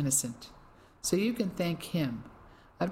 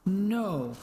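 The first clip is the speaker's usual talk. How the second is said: anguished cry; 5 semitones higher than usual. Acted. Clive hears Tommy is bound for a camp, anguished cry. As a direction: let the word break out of you in pain. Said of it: "an anguished cry".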